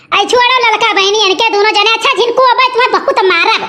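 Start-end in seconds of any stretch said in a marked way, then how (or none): none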